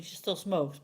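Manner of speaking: ordinary talk, nothing marked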